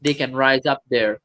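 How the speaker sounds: ordinary talk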